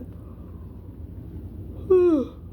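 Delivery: long high sighing yawn; big yawn. Real, not acted. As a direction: let out a big tired yawn. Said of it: "'cause I'm yawning".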